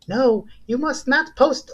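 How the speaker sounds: an unplanned high voice that slips out; first try at the angel voice. Pretend. The good conscience pleads with him, in a high, slow voice. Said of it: "why am I doing the voice like this".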